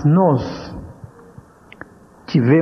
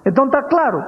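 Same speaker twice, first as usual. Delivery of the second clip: stern mother; 9 semitones higher than usual. Retold or imitated. Mother confirming.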